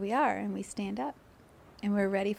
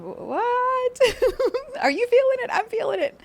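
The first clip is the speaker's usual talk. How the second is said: squealing, much higher, excitedly; 7 dB louder than usual. Retold or imitated. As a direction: shriek it like a thrilled fan. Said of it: "here we are going".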